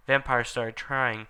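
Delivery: normal talking voice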